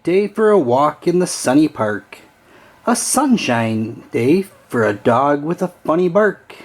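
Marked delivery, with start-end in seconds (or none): none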